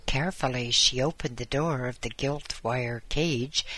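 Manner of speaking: usual speaking voice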